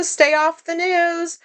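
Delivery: high and slow, a teasing joke; joking drawl. Retold or imitated. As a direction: stretch it out as a tease. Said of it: "as a joke".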